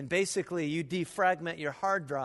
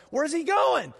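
startled question; 8 semitones higher, 5 dB louder than usual. Retold or imitated. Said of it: "I'm going".

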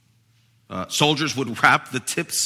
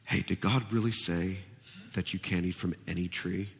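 softer, lower sly murmur; subtle insinuation; 5 semitones lower, 10 dB quieter than usual. Retold or imitated. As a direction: plant doubt in a hushed, coaxing tone. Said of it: "he subtly exaggerates".

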